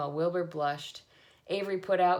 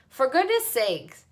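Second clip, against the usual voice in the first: loud, higher, slowed bellow; 7 semitones higher, 8 dB louder, slower than usual. A narrator's reading. Zuckerman bellows at Lurvy, drenched and loud. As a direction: bellow in soaked outrage. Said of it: "bellowed Mister Zuckerman".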